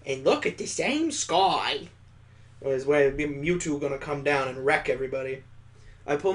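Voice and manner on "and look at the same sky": slow, dreamy mock recital; lightly mocking the game's line; mock quote. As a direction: quote it back in a slightly hammed-up voice. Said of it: "this game's quoting me".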